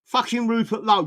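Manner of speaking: normal talking voice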